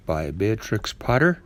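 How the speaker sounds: ordinary delivery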